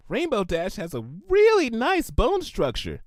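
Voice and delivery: sweet but unsettling; high pony voice, adoring in a creepy way; creepily admiring. A narrator's reading. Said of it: "endearing if it wasn't endlessly creepy".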